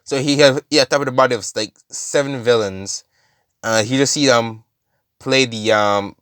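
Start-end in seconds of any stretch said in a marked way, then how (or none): none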